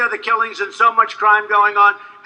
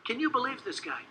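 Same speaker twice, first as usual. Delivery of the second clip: much softer, a private aside; muttered to himself; 17 dB quieter than usual. Retold or imitated. Muttering to himself while a friend pleads on the phone, quiet disbelief. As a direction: mutter it under your breath in disbelief. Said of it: "And I'm saying to myself".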